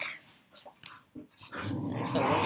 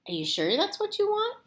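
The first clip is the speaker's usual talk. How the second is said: doubtful mom; 12 semitones higher than usual. Acted. Mom doubts strange request.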